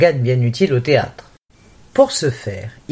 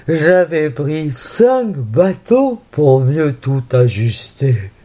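slow, measured theatrical declamation; classical verse recital; slower than usual. Shown as a demonstration. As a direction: declaim it like alexandrine verse on stage. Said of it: "Un petit extrait du Menteur de Corneille".

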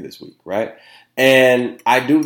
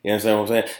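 ordinary talk

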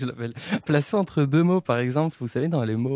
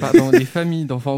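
familles said louder, pressing the fixed word; louder correction; 9 dB louder than usual. Real, not acted. Host corrected by co-host, louder, insisting on familles. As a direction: blurt out the right words after being caught. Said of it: "t'as dit les enfants d'enfants hospitalisés".

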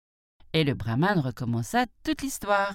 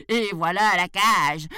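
loud, higher, emphatic; exasperated tiger; 4 semitones higher, 6 dB louder than usual. A narrator's reading. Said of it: "enragé". (tigre exaspéré, fort)

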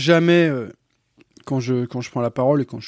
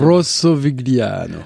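slow, savoured Italian pronunciation; Italian accent; 3 dB louder, much slower than usual. Real, not acted. Guest reading the wine's name aloud, slow Italian accent. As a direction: roll it out slowly like an Italian. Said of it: "tu fais mieux que moi".

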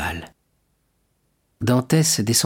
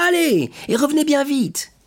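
much higher shout; crowd cry. Acted. Tous les amis pressant l'armateur de revenir. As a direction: urge him to hurry back. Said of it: "crièrent toutes les voix".

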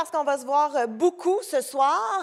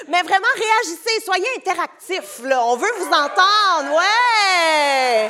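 loud, drawn-out cheer; slow, loud call building into a long cheer; rallying the crowd; 3 semitones higher, 6 dB louder, slower than usual. Real, not acted. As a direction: whoop to get the audience roaring. Host rallies crowd to react.

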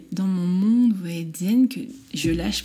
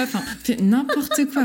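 laughing; 8 semitones higher, 5 dB louder than usual. Real, not acted. Laughing at her silly reaction.

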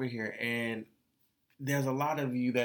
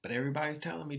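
ordinary talk

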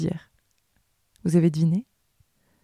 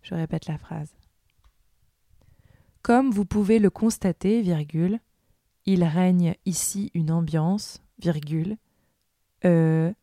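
dictating; 6 dB quieter than usual. Acted. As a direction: read it out like a school dictée, word by word. Dictée read aloud with punctuation.

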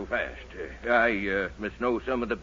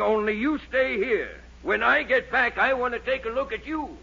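suspicious warning; 10 semitones higher than usual. Acted. Doctor suspicious of Dennis.

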